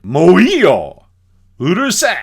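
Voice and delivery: imitated furious shout, high and loud; angry shout. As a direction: bark it at him to shut him up. Medicine man shouts him down.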